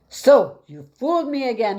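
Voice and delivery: high, loud furious shout; a loud, high-pitched angry shout. Acted. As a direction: roar it in his face. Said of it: "he shouted".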